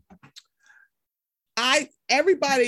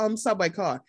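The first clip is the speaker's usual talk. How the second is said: usual speaking voice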